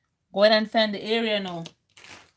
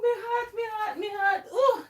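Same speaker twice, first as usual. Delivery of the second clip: high, soft, shaky cry of fright; high shaken moan after a scare; 9 semitones higher, 4 dB quieter than usual. Real, not acted. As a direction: gasp it out, still shaken.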